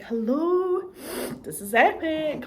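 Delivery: high, loud gushing; excited hello. Retold or imitated. Watching the first season at home, a high excited hello. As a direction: squeal it out, thrilled. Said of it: "just being like".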